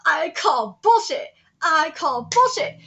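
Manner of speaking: slow, loud I call bullshit. Real, not acted. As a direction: drag it out loudly in protest.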